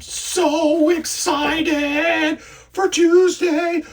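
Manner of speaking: high, slow, drawn-out squeal; excited squeal. Real, not acted. Host gushing to viewers about Tuesday, high and slow. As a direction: stretch it out in high glee. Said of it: "I'm so excited".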